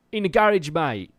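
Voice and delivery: put-on loud, high English "mate" accent caricature; hammed-up British accent, called out loud; British regional accent. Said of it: "no one in the U S is going".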